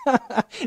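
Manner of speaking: a scornful laugh; mocking laugh. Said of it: "se empezó a burlar de ellos".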